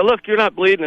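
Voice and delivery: high-pitched mock pleading; retold anxious denial. Retelling panicked reassurance to brother.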